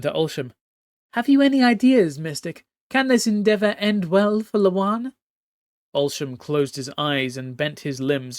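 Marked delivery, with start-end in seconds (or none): 1.1-5.1 high regal voice, commanding and cold; imperious empress. Acted. Empress Lawan overruling her nervous captain, haughty high command. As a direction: speak as a haughty sea empress giving orders.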